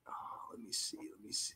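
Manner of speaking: very soft, unvoiced searching aside; whispering to self. Real, not acted. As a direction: whisper it absently while looking something up. Muttering while searching for poem.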